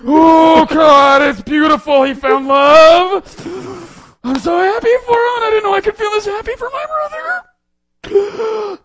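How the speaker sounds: high, loud, wailing sob through the words; sobbing. Retold or imitated. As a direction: blubber it out, crying uncontrollably. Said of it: "dripping with snot and tears".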